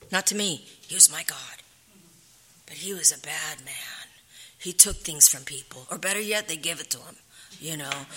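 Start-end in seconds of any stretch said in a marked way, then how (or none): 2.7-4.1 whispered, hushed judgement. Real, not acted. Wife describing her husband to listeners, whispered. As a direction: drop to a whisper on it.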